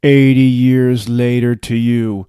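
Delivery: low, slow announcer; time-skip narrator. A narrator's reading. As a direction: intone it like a scene caption. Narrated time-skip card in story.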